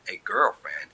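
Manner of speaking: ordinary delivery, nothing marked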